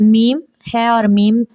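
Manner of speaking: ordinary talk, nothing marked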